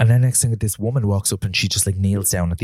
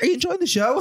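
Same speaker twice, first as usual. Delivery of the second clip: much higher mock-sweet question; fake polite. Him to nosy stranger, high mock-sweet politeness. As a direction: ask sweetly, pitched way up. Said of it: "I was like".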